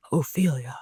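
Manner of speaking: low, hushed deep voice. A narrator's reading. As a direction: drop low to call her name. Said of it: "a deep voice said".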